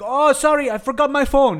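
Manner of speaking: high, loud apologetic cry; called-out excuse. Retold or imitated. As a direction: call out a hurried fake excuse. Acted excuse called to bus staff.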